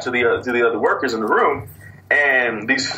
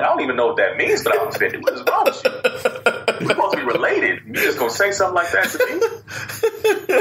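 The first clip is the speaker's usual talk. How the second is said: high-pitched, breathy squeal of mock offence; mock outrage; 12 semitones higher than usual. Answering the cousin who insulted him, squealing mock offence. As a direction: shriek your hurt feelings like a wounded relative.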